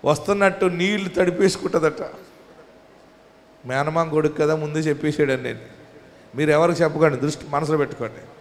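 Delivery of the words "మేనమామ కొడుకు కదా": usual speaking voice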